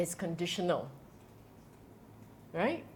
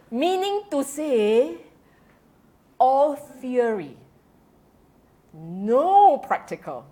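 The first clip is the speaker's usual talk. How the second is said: slow emphasis; 3 semitones higher, 4 dB louder, slower than usual. Real, not acted. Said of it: "spells out the point slowly".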